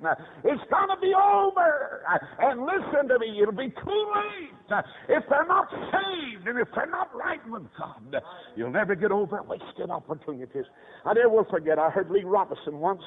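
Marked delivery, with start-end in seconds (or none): 0.4-8.1 high, pleading preacher's cry; urgent preaching. Real, not acted. Preacher warning listeners it will be too late, urgent.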